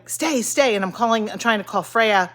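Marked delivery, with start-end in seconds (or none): 0.1-0.8 commanding the dog. Retold or imitated. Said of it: "and then I'm going".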